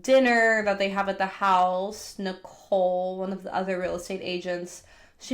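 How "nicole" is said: ordinary delivery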